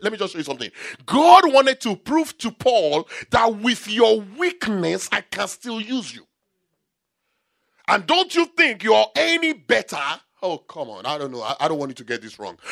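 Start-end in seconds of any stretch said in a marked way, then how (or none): 1.0-10.0 loud, raised sermon exhortation; full-force preaching. Acted. Preacher telling what God wanted to prove to Paul, full-force preaching. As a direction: drive the message home at high volume.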